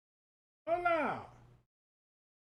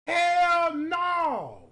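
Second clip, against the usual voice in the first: HELL shouted higher and louder; 6 semitones higher, 7 dB louder than usual. Real, not acted. Comic reacts to a photo, shouting Hell no. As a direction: yell Hell no harder.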